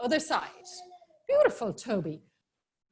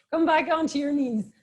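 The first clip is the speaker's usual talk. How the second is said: ordinary talk, nothing marked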